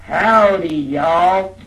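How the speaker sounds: Howdy, y'all loud and high, Texan drawl; proper Texas greeting. Shown as a demonstration. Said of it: "There you go".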